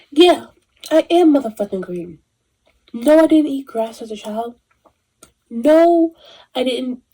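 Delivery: loud sassy defiance; defiant reply. Retold or imitated. Answering society, loud defiant sass. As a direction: talk back with attitude. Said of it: "And I'll be like".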